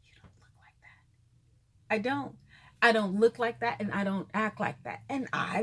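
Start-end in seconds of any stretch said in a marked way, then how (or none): 0.0-2.0 hushed, stunned cashier whisper; a soft, slow astonished whisper; amazed whisper. Retold or imitated. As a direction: breathe it out in disbelief. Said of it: "she was like".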